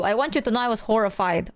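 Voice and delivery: ordinary talk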